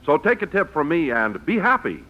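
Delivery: usual speaking voice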